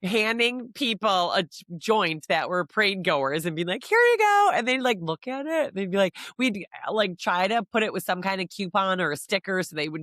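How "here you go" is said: bright, much higher, louder handoff; cheery offer. Retold or imitated. Handing parade goers a joint, chirpy and high. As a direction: hand it over with a big sing-song lift.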